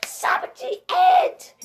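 high, loud cheer, slowing on the number; excited cheer. Real, not acted. Child celebrating a game score, cheering high and loud. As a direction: whoop it out like you just won.

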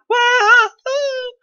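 high mock cheer, slow; sarcastic cheer. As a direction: fake upbeat singsong. Mock cheer after complaining.

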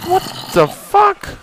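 a high, startled squeal at the zombie; shocked shriek. Real, not acted. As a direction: yelp it in real alarm. Scared by a zombie noise.

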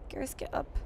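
whispered plea; hushed mock urgency; urgent whisper. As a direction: whisper it to a fallen friend. Begging downed squadmate.

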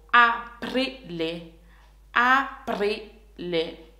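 slow aprile. Shown as a demonstration. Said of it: "we're going to learn them slowly".

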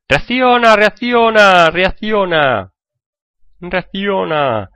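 high yell that slows and drags out; frustrated shout. Real, not acted. Yelling at lagging game. (gritando exasperado al juego que se cuelga)

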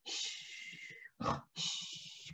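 soft hushing; sleeping bear, shh; whispered shh. Acted. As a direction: shush the children for the sleeping bear.

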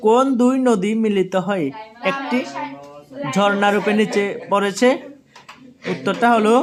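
ordinary talk

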